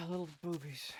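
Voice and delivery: breathy unvoiced whisper; a sighing hushed whisper. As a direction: whisper it with a sigh. Sighed whisper of nickname.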